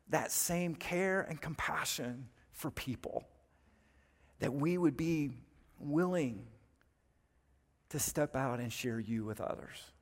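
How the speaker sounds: gentle pastoral prayer. Acted. Closing prayer.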